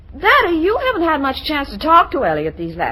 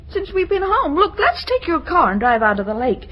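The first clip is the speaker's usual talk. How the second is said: usual speaking voice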